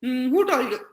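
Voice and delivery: mumbled through pressed lips; tight-lipped. Retold or imitated. Child to mother with lips held shut, tight-lipped. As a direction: keep the lips tight while asking. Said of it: "keeps his lips tight and then says".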